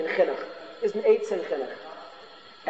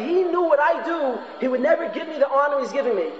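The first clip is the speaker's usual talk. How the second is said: higher, slowed, wondering student voice; student's inner thought; 6 semitones higher than usual. Acted. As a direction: play the student marvelling to himself, pitched up. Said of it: "the person would start saying something".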